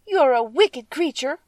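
high, loud, slow accusation; a girl shouting at the witch; crying out angrily. Acted. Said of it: "cried Dorothy".

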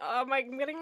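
normal talking voice

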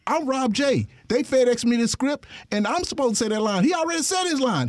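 high, heated objection; retelling his indignant cry at the director, pitched high; indignant protest. Retold or imitated. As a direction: protest like your line was stolen. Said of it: "So I'm getting mad".

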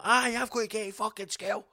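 high-pitched put-on wee kid voice; mock schoolkid. Wee kid pops out of Range Rover, mock schoolkid squawk. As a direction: squawk it like an indignant Scottish child. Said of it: "Obviously not in that accent".

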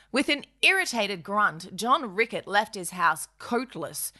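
usual speaking voice

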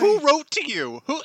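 high, loud disbelieving squeak; surprised squeal. Real, not acted. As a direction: squeal it out in shock. Mock shock at the letter.

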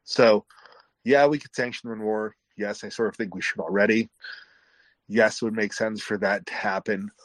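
ordinary talk, nothing marked

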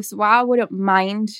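ordinary delivery

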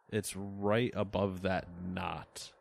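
normal talking voice

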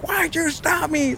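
high, slow protest without real volume; mock-yell it high and stretched; retold yell. Suspect talking back to the police officer, high protesting mock yell.

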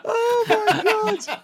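high, drawn-out words through laughter; helpless laughter. Real, not acted. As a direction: squeal it out while laughing. Still laughing at the punchline.

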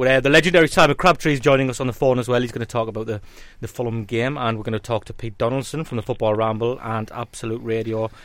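ordinary delivery

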